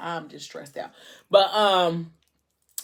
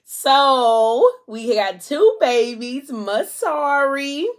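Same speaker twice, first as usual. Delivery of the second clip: higher, louder, very slow, drawn out; proud announcement; 7 semitones higher, 7 dB louder, much slower than usual. Retold or imitated. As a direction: stretch out each word like a big reveal.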